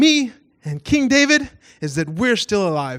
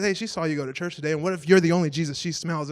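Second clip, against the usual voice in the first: inner voice; 5 semitones lower, 6 dB quieter, faster than usual. Retold or imitated. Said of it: "the voice inside me said".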